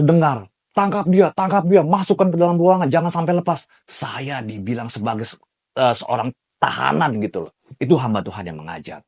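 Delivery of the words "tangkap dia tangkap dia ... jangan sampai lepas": high, loud crowd yell; passersby yelling to grab him; retold shouting. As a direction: shout orders to seize someone. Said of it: "orang-orang di pinggir jalan meneriak".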